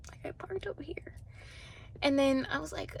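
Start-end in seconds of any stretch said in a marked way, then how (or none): 0.1-1.1 whispered protest. Retold or imitated. Confused at being redirected.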